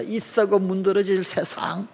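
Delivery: high, slow mock lament; venting pent-up resentment. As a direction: drag out a bitter curse at the world. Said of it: "그냥 울분을 토하면서". (울분 토하는 한탄 흉내)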